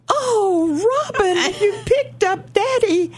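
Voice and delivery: high, tearful cry of surprise. Retold or imitated. Mother overcome seeing her husband with flowers, crying. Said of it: "of course, I started crying".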